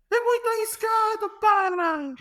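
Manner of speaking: imitated coach's yell, pitched very high; Jorge Jesus shouting. Coach rebukes player for a risky pass, high mock shout. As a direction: scold in a squeaky mock shout. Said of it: "ele grita assim".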